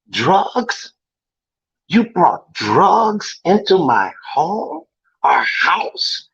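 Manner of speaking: stern parent confronting his son, pitch swinging with disbelief; angry, heartbroken father. Acted. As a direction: act out a father's hurt outrage, punching key words. Acted audition monologue, father confronts son.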